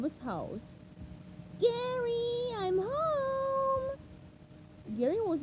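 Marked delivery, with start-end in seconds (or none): 1.6-4.0 much higher, bright sponge voice calling home; cheerful SpongeBob. Acted. SpongeBob coming home to his snail, high and cheerful. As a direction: sing out a happy hello to your pet.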